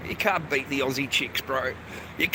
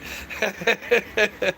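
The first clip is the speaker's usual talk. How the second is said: higher-pitched chuckling run; sustained giggling laughter; real laugh; 5 semitones higher than usual. Real, not acted. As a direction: crack up at your own joke. Laughs at own acted thought.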